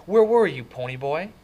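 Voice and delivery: loud, high angry demand; rising anger. A narrator's reading. Brother demanding where kid was, voice rising angrily. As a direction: voice climbing as you demand an answer. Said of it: "His voice was rising".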